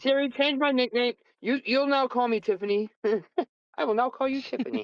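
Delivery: mock Siri exchange. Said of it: "changing their name with Siri".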